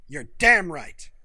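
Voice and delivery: loud, slow exclamation; exclaimed. Acted. Jenkins agrees he thinks little of people, slow loud exclaim. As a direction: blurt it out with full force. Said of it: "Jenkins exclaimed".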